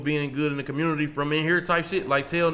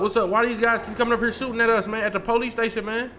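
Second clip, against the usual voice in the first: agitated complaint; 7 semitones higher than usual. Acted. Complaining about shooters.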